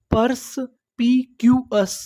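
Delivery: "Purse पी Q एस" with a clear pause between pieces; broken into syllables. Shown as a demonstration. Teacher assembling the new word, syllable by syllable. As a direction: sound out "purse पी Q एस" one piece at a time. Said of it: "टुकड़ों में तोड़-तोड़ के पढ़ना है".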